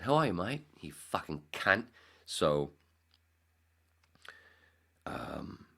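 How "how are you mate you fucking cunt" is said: louder, higher Australian greeting, slowing on the insult; mock Australian accent. Australian friend greeting him, mock Australian accent. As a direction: put on a hearty Australian accent. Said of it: "She said".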